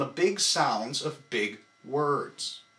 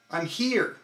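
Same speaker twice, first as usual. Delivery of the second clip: here punched from the core, I'm left light; I'm here with the weight on here; stress on here; 3 semitones higher than usual. Shown as a demonstration. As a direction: tighten the stomach on here. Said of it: "but on here".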